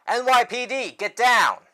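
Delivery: loud, high-pitched command; police shout. Acted. Detective orders a scattering crowd down, urgent shout. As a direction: shout it over a panicking crowd.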